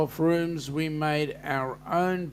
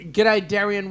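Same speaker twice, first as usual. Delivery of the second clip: loud greeting; 5 semitones higher, 7 dB louder than usual. Real, not acted. Greeting a viewer Aussie style.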